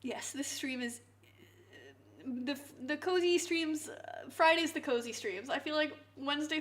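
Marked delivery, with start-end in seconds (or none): 0.0-2.6 whisper. Real, not acted. Quiet start of an explanation.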